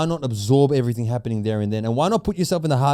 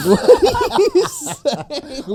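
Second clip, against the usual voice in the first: high laughing disbelief, very slow; 14 semitones higher, 5 dB louder, much slower than usual. Real, not acted. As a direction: laugh through the question. Laughing at slip.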